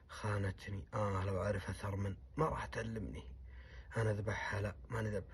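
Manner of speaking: low, soft inner voice; brooding to himself. Retold or imitated. Betrayed sheikh brooding to himself, low and quiet. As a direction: mutter it as private bitter thought.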